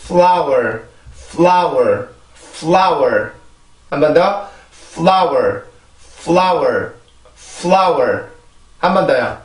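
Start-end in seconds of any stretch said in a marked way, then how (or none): 0.0-3.3 "flower" with short F, stressed L, final R; English pronunciation demo. Shown as a demonstration. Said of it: "그래서 이렇게 발음을 합니다". 4.9-8.2 "flower" with short F, stressed L, final R; English pronunciation demo. Shown as a demonstration. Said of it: "그래서 이렇게 발음을 합니다".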